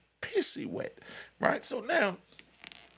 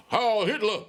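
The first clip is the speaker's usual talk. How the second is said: a retold drunken yell, a bit louder; loud mock yell; 6 semitones higher, 7 dB louder than usual. Drunk skinheads hailing each other in the yard, retold mock yell. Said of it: "they real loud with it".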